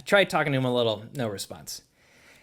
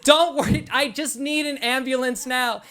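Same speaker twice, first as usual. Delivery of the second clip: urgent shout; 10 semitones higher than usual. Retold or imitated. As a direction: yell for help across the water. Yelling urgently to distant fisherman.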